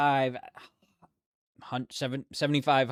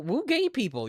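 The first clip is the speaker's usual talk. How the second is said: squealing woo, pitched way up; high excited cheer; 12 semitones higher than usual. Real, not acted. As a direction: cheer it like a rally. Cheering louder to chat.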